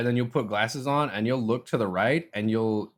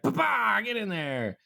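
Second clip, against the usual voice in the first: high-pitched mock shout, dropping as it goes; retold triumphant cry while jamming it in; mock battle cry; 11 semitones higher, slower than usual. Retelling stabbing the contact into own eye, mock battle cry.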